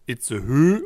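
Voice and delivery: Itzehoe ending in an ö vowel, "Itzehö"; wrong pronunciation. Shown as a demonstration. Podcaster rejecting a mispronunciation, Itzehoe ending in a stressed ö. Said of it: "Es heißt nicht Itzehö".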